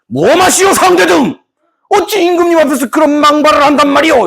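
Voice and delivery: high, declaimed period-drama rebuke; hammed-up scolding courtier; indignant court official. Chief secretary scolds Sangdaedeung before the king, mock indignation.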